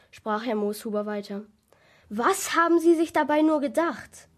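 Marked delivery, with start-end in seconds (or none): 2.1-4.3 loud, higher, indignant question; stern reproach. Acted. Policeman confronting the shop owner, sternly reproachful. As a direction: demand an explanation from a culprit.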